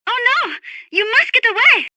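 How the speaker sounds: high, anxious cry; alarmed urging. Acted. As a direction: urge him to flee in alarm. Alarm, Snake in danger.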